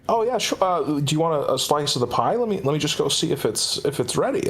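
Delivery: put-on a creepy-kind old man offering pie; sweet old host. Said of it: "And he's like".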